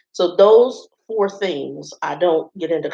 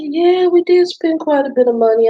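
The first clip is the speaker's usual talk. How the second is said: bright polite reply; 11 semitones higher, 4 dB louder than usual. Retold or imitated. Her diplomatic reply to the stranger.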